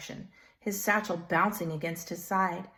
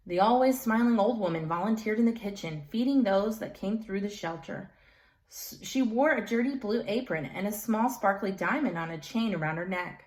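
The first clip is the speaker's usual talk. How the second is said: ordinary delivery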